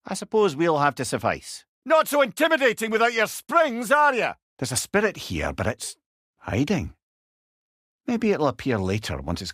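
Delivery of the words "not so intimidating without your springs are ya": taunting shout. Acted. Taunting a defeated foe.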